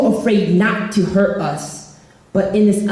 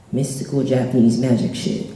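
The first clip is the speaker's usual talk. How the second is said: usual speaking voice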